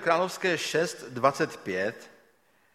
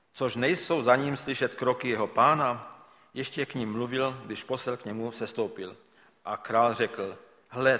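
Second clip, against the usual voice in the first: scripture reading; 3 semitones lower, 4 dB quieter than usual. Acted. Reads scripture passage aloud.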